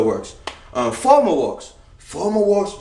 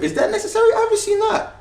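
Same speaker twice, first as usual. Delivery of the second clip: squeaky mock voice. High squeaky self-question.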